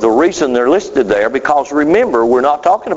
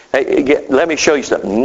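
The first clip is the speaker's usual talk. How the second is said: ordinary talk, nothing marked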